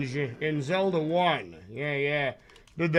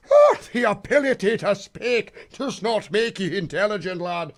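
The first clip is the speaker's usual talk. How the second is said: Qui-Gon in a bad Irish accent; 3 semitones higher than usual. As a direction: bellow the wise master line like a drunken Irishman. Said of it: "Qui-Gon Jinn getting the le".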